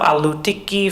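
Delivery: normal talking voice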